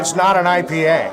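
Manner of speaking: ordinary talk, nothing marked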